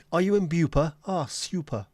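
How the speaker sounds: loud, mocking posh English toff; mock the posh English way of saying super, with a y sound; posh English accent. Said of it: "Never trust people who say super as well".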